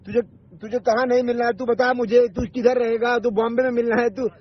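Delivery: usual speaking voice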